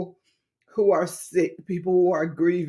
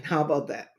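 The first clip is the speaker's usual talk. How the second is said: ordinary talk, nothing marked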